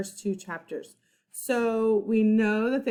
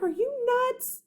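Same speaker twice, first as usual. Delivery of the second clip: incredulous; slower than usual. Acted. Jack scolding Annie.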